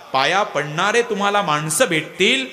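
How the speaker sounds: ordinary delivery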